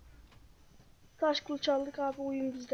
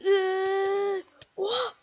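shocked cry; 6 semitones higher, 6 dB louder, much slower than usual. Real, not acted. Sudden shock mid-fight.

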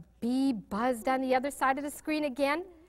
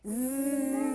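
a buzzing zzz like a bee. Shown as a demonstration. Reader buzzes like the bee for the child. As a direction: buzz it like a bumblebee. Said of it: "Can you make the bee buzz?".

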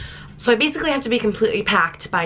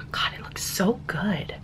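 whispering; 7 dB quieter than usual. Real, not acted. Admiring herself, aside.

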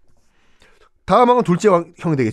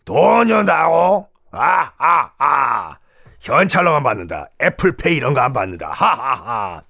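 Viking voice; slower than usual. Acted. 바이킹이 현찰을 요구함.

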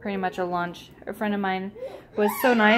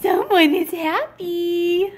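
higher, slower coo on the repeat; 7 semitones higher, slower than usual. Real, not acted. Mother repeats her coo to the baby, even higher.

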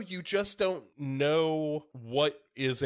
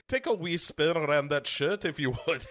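put-on Scottish accent, raised pitch; Billy Connolly; 4 semitones higher than usual. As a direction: offer it like a mock Scottish father. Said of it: "Billy Connolly hands Paul Walker".

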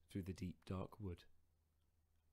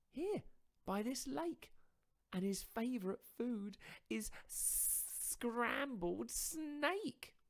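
higher, louder mouse voice, spinning a tall tale; boastful little mouse; 5 dB louder, slower than usual. Acted. Mouse inventing a monster to frighten fox, higher and louder.

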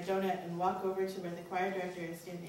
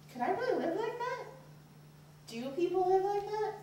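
much higher, pleading questions; anguished questions; 11 semitones higher than usual. Acted. Teen dreading a life of celibacy, high and pleading. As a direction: ask it like you can't believe it.

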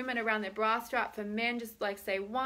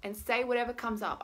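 ordinary talk

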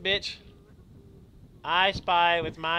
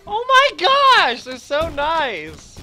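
very high, loud, slow delighted squeal; drawn-out thrilled gush; 11 semitones higher, 13 dB louder, much slower than usual. Real, not acted. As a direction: melt over how nice they are. Gushing over nice characters.

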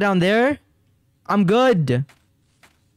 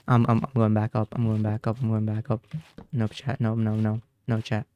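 soft, low, hurried retreat under his breath; frightened murmur to chat; scared hush; 7 semitones lower, 6 dB quieter than usual. Real, not acted. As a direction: back away whispering to himself. Retreating quietly in fear.

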